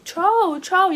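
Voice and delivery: high, loud mock calls of his name; imitating girls yelling. Retelling the girls at the game, high loud mock yelling. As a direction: call his name like a crowd of fans. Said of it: "it was a group of girls yelling".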